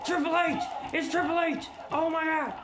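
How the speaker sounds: high-pitched excited call-out; excited exclamation. Real, not acted. Streamer spots a favourite wrestler in the game, excited. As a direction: cry it out, thrilled, pitch leaping up.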